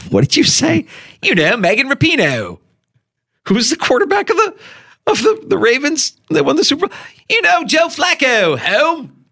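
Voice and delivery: high, put-on Baltimore accent; mock Baltimore local voice. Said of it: "this accent game".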